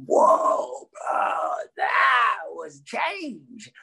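high, slow stunned voice; mock awestruck teen; impression of the son. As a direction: act out the son, amazed. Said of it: "And I was like".

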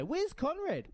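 mock asking where Conrad is, pitched high. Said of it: "And then the next day".

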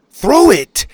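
loud, high demanding yell; shout. Acted. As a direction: bark the order in panic. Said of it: "Dylan shouted".

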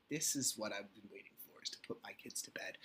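put-on hushed whisper so the sleeping kid won't wake. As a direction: breathe it out, barely voiced. Daughter just fell asleep.

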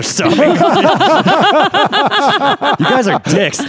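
high squealing laugh. Real, not acted. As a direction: laugh through a mock complaint. Laughing protest at friends.

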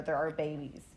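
choking up, voice breaking; reading through real tears; tearful. Real, not acted. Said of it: "Are you crying?".

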